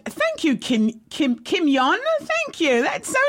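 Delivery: very high, delighted thank-you; gushing thanks. Real, not acted. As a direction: gush sweetly in a high-pitched voice. Thanking a viewer for a compliment.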